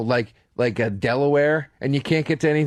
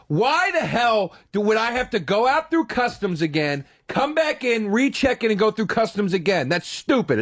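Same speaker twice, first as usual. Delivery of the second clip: retold screaming; 7 semitones higher than usual. To the desk agent, high furious mock screaming. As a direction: rant at him like you've had enough. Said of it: "So I'm screaming at him".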